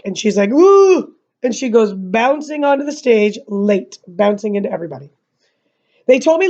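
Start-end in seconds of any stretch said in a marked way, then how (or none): 0.5-1.1 high, loud mock whoop; startled whoop. Scout wakes on the third call, whooping. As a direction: whoop as if jolted awake.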